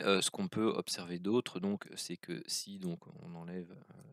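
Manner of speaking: normal talking voice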